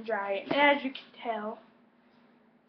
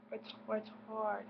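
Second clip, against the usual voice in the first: attempted British accent; 14 dB quieter, slower than usual. Shown as a demonstration. Trying the word out on viewers, unsure British accent.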